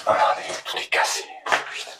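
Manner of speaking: real whisper, dismayed at the damage; hushed dismay. Real, not acted. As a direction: whisper it softly, almost all breath. Hushed dismay at broken ruins. (chuchotement consterné)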